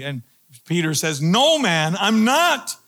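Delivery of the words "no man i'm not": acted emphatic denial, pitch climbing; mock indignant comeback; retort. Peter denying it to his accuser, retorting. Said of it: "Peter retorted".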